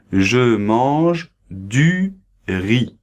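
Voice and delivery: "Je mange du riz" very slow, each word apart; slow example sentence. Shown as a demonstration. Teacher modelling the example sentence very slowly. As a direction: spell it out slowly for learners. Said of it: "Donc voilà, exemple".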